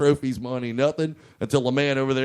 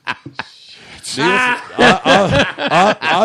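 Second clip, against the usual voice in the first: laughing; 9 semitones higher, 7 dB louder, much slower than usual. Real, not acted. Breaks into laughter mid-story.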